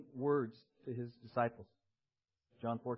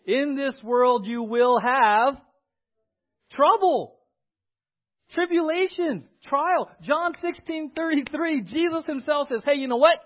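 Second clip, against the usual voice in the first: loud, raised proclamation with punched words; full-force preaching; 5 semitones higher, 12 dB louder than usual. Acted. Preacher quotes Jesus warning of trouble to come, full-force preaching.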